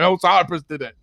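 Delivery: mock callout. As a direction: call it out as the other man did. Retold callout, retaken after laughing.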